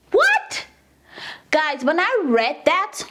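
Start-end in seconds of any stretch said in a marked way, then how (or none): 0.1-0.6 what? shot up very high with a rising tune; a sharp, high, incredulous what; outraged disbelief. Real, not acted.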